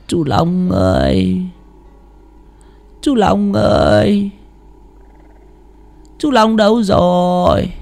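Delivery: put-on high, slow, feeble ghostly old woman calling; faint wheezing call. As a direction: drag each word out weakly from outside the gate. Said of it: "cất tiếng thều thào". (tiếng gọi thều thào, chậm và yếu ớt)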